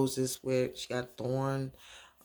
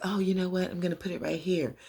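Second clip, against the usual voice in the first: higher, quick, offhand put-on voice; daughter's voice; 7 semitones higher, faster than usual. Daughter setting the rose by mom, higher quick voice. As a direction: lift it and move briskly along.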